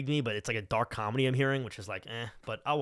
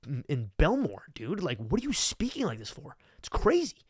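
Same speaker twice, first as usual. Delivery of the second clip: exasperated; 6 semitones higher than usual. Real, not acted. Incredulous rant at the bro.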